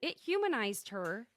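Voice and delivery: usual speaking voice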